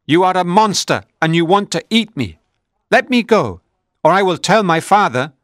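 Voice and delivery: put-on high, loud panicked child's voice; frightened boy. A narrator's reading. A boy threatening the monster with his father, high panicked cry. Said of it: "he squealed".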